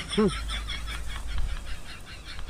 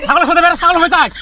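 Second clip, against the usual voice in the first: scolding; 6 semitones higher, 5 dB louder than usual. Acted. Scolding the stoned goat-boy.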